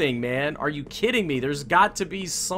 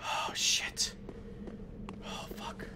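very soft, whispered swearing; shaken whisper; 15 dB quieter, slower than usual. Real, not acted. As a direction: whisper it, stunned after a scare. Recovering right after the scare.

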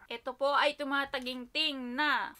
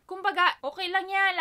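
louder, higher reassuring sister voice; quoting big sister; 7 semitones higher, 10 dB louder than usual. Retold or imitated. Sister cheering her up, louder upbeat imitation. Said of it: "Lagi siyang ganun".

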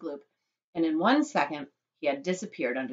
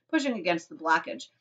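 ordinary delivery, nothing marked

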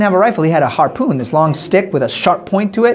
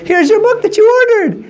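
much higher, bright triumphant offer; cheerful delivery; 13 semitones higher than usual. Acted. Jose cheerfully hands the woman her book, high and bright. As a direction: hand it over gleefully.

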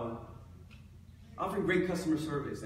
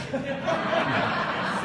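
laugh; 8 semitones higher, 7 dB louder than usual. Real, not acted. Laughs at own joke.

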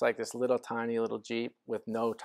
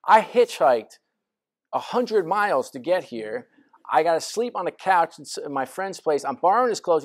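higher and louder, pleading his case hard; a raised, exasperated self-defence; indignant protest; 6 semitones higher, 6 dB louder than usual. Retold or imitated. To the interviewer, he protests his hardship in a raised indignant voice.

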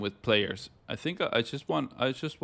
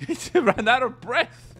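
laughing; 12 semitones higher, 4 dB louder, slower than usual. Real, not acted. Laughing in disbelief.

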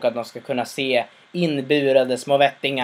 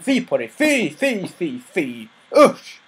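higher, disgusted shaming cries; mock scolding; 4 semitones higher, slower than usual. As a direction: shame him with a childish fy. Crowd scolds the manufacturer.

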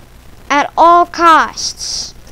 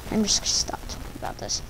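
whispered mutter; 5 semitones lower, 13 dB quieter, much faster than usual. Real, not acted. Giving up on the topic.

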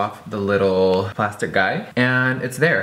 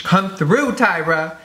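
excited cheer; 9 semitones higher, slower than usual. Acted. Cheering on her finished look.

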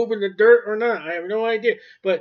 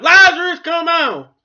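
much higher and louder call; shouted; 10 semitones higher, 10 dB louder than usual. Retold or imitated. Preacher contrasting said and shouted, big loud shout. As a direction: throw the words out with full force. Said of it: "Or do you think Jesus shouted it".

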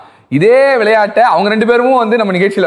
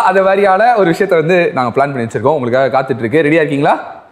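ordinary talk

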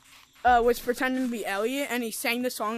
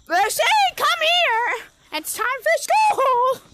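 high, loud call to the dog; loud, high-pitched call with a cry in it; 13 semitones higher, 9 dB louder, slower than usual. Real, not acted. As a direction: shout across the room for the puppy. Calling the dog to come.

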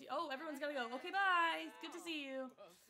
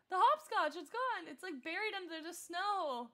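excited outcry; 4 semitones higher, 11 dB louder than usual. Real, not acted. Hopscotch vanished under snow.

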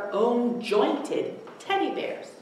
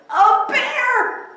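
put-on much higher, louder child's scream; 10 dB louder than usual. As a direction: shriek in fright at a bear. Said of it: "screamed Eddie".

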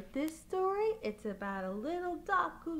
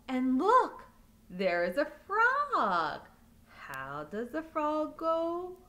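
normal talking voice

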